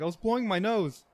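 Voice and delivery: high-pitched, defensive friend; retold protest. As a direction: imitate a friend's high, startled excuse. Friend answers cop at gunpoint.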